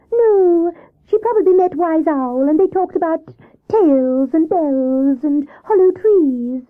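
high, slow storybook squirrel; Squirrel character voice. Acted. Squirrel answers Hare about the missing rabbit, high character voice. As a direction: draw it out in a high, loud Squirrel voice.

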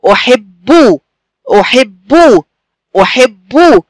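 "أحب" with a held double b and a clear final u; doubled b demonstration. Shown as a demonstration. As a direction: lean on the doubled b in "أحب", ending on "bu". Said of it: "صوت باء ساكنة وبعديها باء مضمومة".

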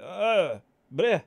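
startled yelps, then plead not to be shot; alarmed visitor. Acted. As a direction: blurt out startled noises, then beg haltingly. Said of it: "And you're like".

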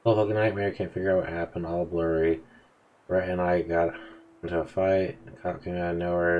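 ordinary talk, nothing marked

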